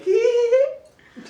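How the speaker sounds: high, drawn-out "hee hee hee" titter; mock giggle. Students reacting to a taboo topic, mock giggle. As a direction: imitate embarrassed students tittering, high-pitched.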